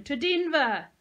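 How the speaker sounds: loud, high overexcited cheer; mocking cheer. A narrator's reading. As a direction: cheer it as a joke. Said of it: "mocking and overexcited".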